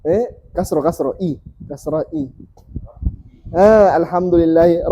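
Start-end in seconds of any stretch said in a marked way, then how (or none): none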